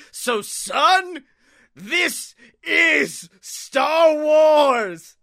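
high, loud shout, hugely drawn out; mock roaring declaration. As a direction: bellow it slowly like an epic battle cry. Mock lecture building to a roar.

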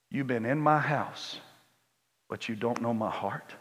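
a loving father speaking quietly to his son; tender father. Retold or imitated. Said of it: "And the daddy says".

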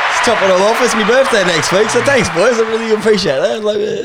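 high, loud laughing shout; loud cheer. Real, not acted. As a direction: yell thanks across the room. Shouts thanks to the boys.

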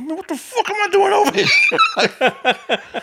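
high, slow, laughing; laughing self-doubt. Retold or imitated. At the old lady's door, slow laughing despair. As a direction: drag it out through laughter.

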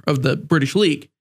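usual speaking voice

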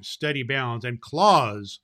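ordinary delivery

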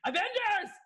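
hammed-up superhero rallying cry; rallying battle cry. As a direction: call the team to battle. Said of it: "he goes".

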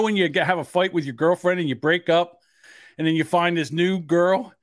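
normal talking voice

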